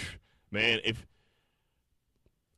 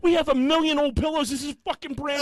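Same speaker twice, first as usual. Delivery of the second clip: high, loud shrill-mocking girlfriend voice; 3 dB louder than usual. Mimicking his girlfriend scolding him about a pillow, mock shrill. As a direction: shriek it as the fussy girlfriend. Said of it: "She was like".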